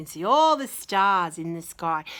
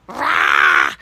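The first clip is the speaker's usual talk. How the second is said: bear roar; 13 dB louder than usual. Acted. Bear tries to eat hero.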